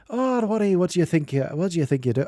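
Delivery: a stab at a Scottish voice that slips into Irish; playful put-on Irish accent. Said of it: "Oh, that was Irish".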